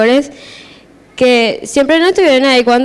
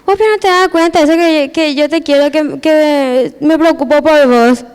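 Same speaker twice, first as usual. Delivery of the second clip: higher-pitched imitation of a reproach; retold worried scolding; 5 semitones higher than usual. Worried relative scolds the speaker, retold higher-pitched reproach. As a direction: plead and scold at once, pitched up.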